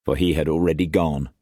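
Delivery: ordinary delivery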